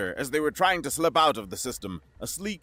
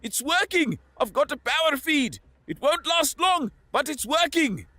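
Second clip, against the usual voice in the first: high excited yell; joyful shouting; 3 dB louder than usual. A narrator's reading. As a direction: cry out in triumph. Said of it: "Marco screamed with joy".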